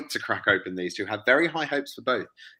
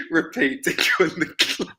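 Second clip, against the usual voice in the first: breathless laugh; 6 semitones higher than usual. Real, not acted. Repeating joke through laughter.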